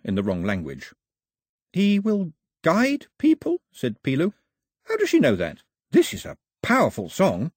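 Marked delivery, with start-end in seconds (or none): 1.7-7.5 put-on higher, quick-talking young sailor; a chatterbox who can't stop telling it; eager chatty Pilu. Pilu impressed by song.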